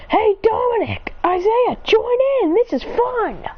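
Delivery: high, excited invitation; gleeful calling out. Acted. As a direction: call your friends over to join the fun. Acted calling friends to join.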